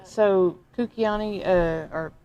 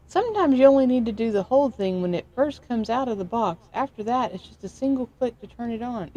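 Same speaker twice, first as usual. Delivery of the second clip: mock Mountain Man; 6 semitones higher, 3 dB louder than usual. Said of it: "Mountain Man says".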